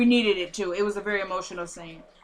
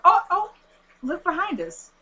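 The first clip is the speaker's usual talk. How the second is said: high-pitched retold warning, loud at first; acted alarm; 10 semitones higher, 4 dB louder than usual. Warning that someone is following the car, acted alarm. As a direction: cry out high on the oh, then ease off.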